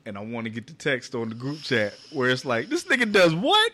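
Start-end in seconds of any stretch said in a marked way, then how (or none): 2.7-3.7 high, loud mock disbelief; exaggerated incredulous question; imitated shocked reaction. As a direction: act out a friend's stunned outburst. Acts group chat's shocked reaction.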